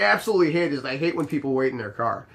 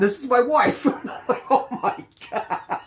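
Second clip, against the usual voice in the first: real laughter breaking into the words; cracking up; 8 semitones higher than usual. Real, not acted. Doorman retelling the wife reveal, cracking up. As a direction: burst out laughing through the line.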